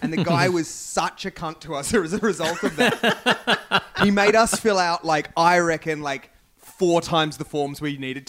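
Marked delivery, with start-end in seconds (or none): none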